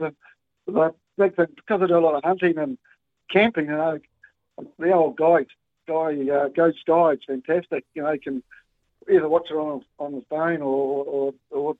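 ordinary talk, nothing marked

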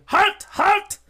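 high, very loud shout; gunman's high-pitched screamed order; screamed command. Acted. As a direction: yell it to stop an armed attacker. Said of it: "he stops screaming".

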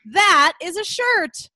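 slow, high, loud imitated shade; mock backhanded comment. Speaker imitates family commenting on their clothes, mock-polite shade. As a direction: draw it out, pointedly withholding praise. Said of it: "they'll say things like".

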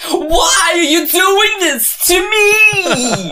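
high, loud, slow sobbing wail, laughing through it; over-the-top crying plea; mock tragic wail. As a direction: wail it like a heartbroken ham. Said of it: "a New York accent".

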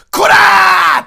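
loud high threatening shout; menacing yell. Retold or imitated. Warning off the rock star with a yell. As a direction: bark it out to scare him off. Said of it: "威嚇しながら".

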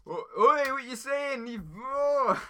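high-pitched mock "oi" challenge; mock outraged. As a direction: flare up in hammed-up indignation. Mock outrage after accent accusation.

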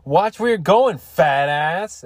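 high, loud jeering call, rising on fat ass; mock taunt. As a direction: sing out a schoolyard insult. Retold taunt from the fat girl.

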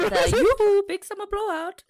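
high, loud Swedish sing-song; chirpy Swedish accent, way up high. Retold or imitated. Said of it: "What's a Swedish accent sound like?".

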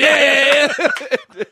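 loud drawn-out cheer; cheering crowd. Retold or imitated. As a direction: cheer him on like the whole team. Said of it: "everyone was cheering and going".

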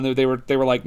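ordinary talk, nothing marked

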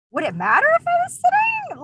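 high, incredulous falsetto retort; sarcastic mock reply. Cashier mocking a customer's rude question in high falsetto. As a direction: toss it back sky-high and sarcastic.

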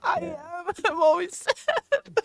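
sad, high-pitched cartoon kid; mock-weepy squeaky sidekick; Morty. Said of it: "Sounds like you're a sad Morty".

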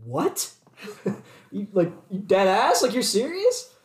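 incredulous questions climbing high at the end; retold disbelief. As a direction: act out baffled disbelief, pitch rising into the last question. Acted disbelief at girlfriend's complaint.

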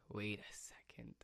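unvoiced, secretive whisper. Real, not acted. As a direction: breathe it as a sly realisation. Realising the username is back.

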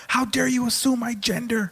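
high-pitched offended heckler imitation; mock indignation. Humanoid heckler to the host, mock indignant. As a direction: sound outraged in a mocking high voice.

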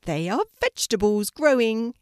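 high, loud little rabbit voice; gentle rabbit calling out to a scared puppy; bright high rabbit, loud. A narrator's reading. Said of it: "answered the rabbit".

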